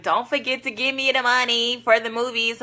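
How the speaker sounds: hammed-up loud version; mock loud. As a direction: exaggerate it as if shouted. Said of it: "You acting like I said it loud".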